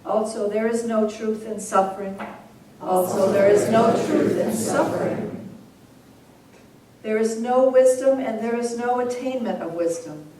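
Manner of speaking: measured, solemn recitation of scripture, each line repeated; sutra recitation. Acted. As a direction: read each verse out steadily, then say it again.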